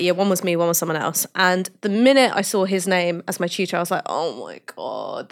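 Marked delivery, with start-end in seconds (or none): 4.0-5.3 low, slow, quiet groan of dread; hushed, drawn-out dismay; mock dread. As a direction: sink into a retold 'this is doomed' moan. Retold dread on seeing tutor's name.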